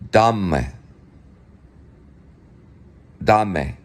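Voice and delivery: "Dame" said the German way, DAH-meh; two-syllable German "Dame". Shown as a demonstration. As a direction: demonstrate "Dame" with open ah. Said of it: "So think of D".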